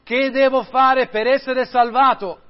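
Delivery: high, loud pleading cry; desperate cry. A narrator's reading. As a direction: shout it like a man who can't bear his burden. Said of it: "Mentre leggeva gridò".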